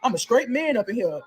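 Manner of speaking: a hint of a deep, cocky prisoner voice; deepened voice. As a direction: drop the voice a little for a swaggering stud. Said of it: "her voice got deep".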